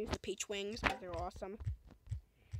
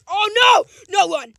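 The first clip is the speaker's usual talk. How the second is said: much higher and louder, frantic; real panic; panicked shout; 10 semitones higher, 23 dB louder than usual. Real, not acted. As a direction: scream to flee. Enemy chases.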